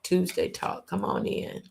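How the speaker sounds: breathy whispered echo of the show name. Acted. Host echoes his show's name in a whisper.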